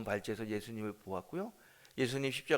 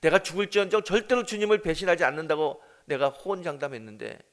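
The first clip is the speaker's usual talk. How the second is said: louder, higher bitter outcry; anguished lament; 5 semitones higher, 10 dB louder than usual. Acted. Peter lamenting his broken vow, loud bitter cry.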